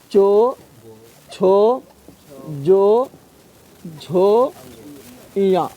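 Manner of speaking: each syllable alone, with a pause after it; slow syllable drill. Shown as a demonstration. Teacher drilling students on palatal consonants, syllable by syllable. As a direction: sound out every syllable clearly for the class. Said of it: "चो चो बोल".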